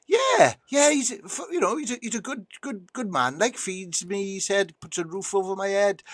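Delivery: high, enthusiastic dog voice; eager talking dog. Acted. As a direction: gush about the owner as a high-voiced dog. Dog praises its owner in joke.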